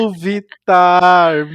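loud, slow, drawn-out big reveal; excited announcement. Real, not acted. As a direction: announce the name like a showstopper. Big reveal of the guest singer.

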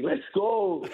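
high-pitched, held-back victory yell; little scream demo. Shown as a demonstration. Pitcher demos his scream for the host in the morning, restrained high yell. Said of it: "give me a little version of the scream".

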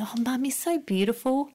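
light impression of a small child adoring mum; little child's voice. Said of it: "your little one will say".

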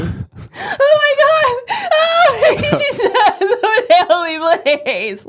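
high, loud, sobbing panicked shout, breaking into a laugh; fake panic. Proving to the podcast friends how easily panic is faked, a loud sobbing mock scream. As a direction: scream like you just found a body, half laughing. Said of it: "I feel like I could fake panic pretty easily".

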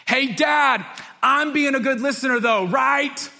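put-on high, loud little girl calling from bed; little daughter's voice. Pastor voicing his young daughter calling out from her bed, high and bright. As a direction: pitch it up like a small child asking from the dark.

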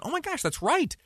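high, surprised gasp of memory; sudden realization. Retold or imitated. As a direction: voice the listener remembering with a jolt. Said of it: "You might be thinking to yourself".

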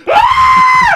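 very high, loud shriek of panic; mock scream. Co-host reenacting panic as luggage bins pop open, mock scream. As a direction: let out a piercing comic scream. Mimics own scream during landing.

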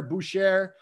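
"Boucher" said as boo-SHARE, r sounded; half-French pronunciation. Shown as a demonstration. Host running through the options, "Boucher" as boo-SHARE. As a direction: end "Boucher" like the word share.

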